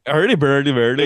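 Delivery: sing-song mock Swedish Chef gibberish; mock Swedish Chef accent. Joining in the Swedish Chef bit, mock Swedish Chef accent. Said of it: "the Muppets chef accent".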